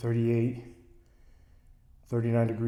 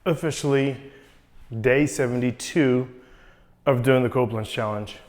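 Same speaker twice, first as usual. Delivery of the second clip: loud intro; 2 semitones higher, 7 dB louder than usual. Real, not acted. Intro filmed before cold plunge.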